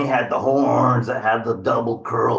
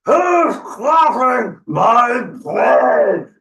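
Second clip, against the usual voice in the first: high, drawn-out troll shout; menacing troll roar; 13 semitones higher, 6 dB louder, much slower than usual. Acted. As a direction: roar it slowly, stretching every word. Troll challenges goat on the bridge.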